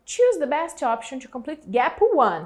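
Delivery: measured teacher reading; slow exercise read. Shown as a demonstration. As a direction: read the question slowly and clearly to the class. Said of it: "teacher reads the exercise aloud".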